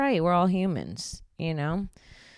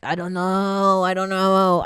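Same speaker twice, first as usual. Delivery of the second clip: loud slow crazed woman yelling; mock crazy yelling; 2 semitones higher, 10 dB louder, slower than usual. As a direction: bellow it like a lost crazy woman. Woman in New York screaming.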